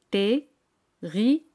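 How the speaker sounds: Terrible drawn out very slowly with a French uvular r; slow French demo. Shown as a demonstration. Teacher slowly models Terrible in French for students to repeat. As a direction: stretch Terrible out slowly so students can copy it. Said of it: "La palabra terrible en francés".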